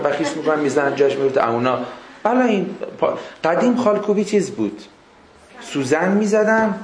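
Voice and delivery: ordinary delivery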